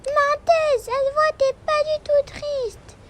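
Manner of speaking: put-on high, loud child calling her mother a liar; a four-year-old calling out a lie; small girl scolding her mother for lying, high pitched; accusing little girl.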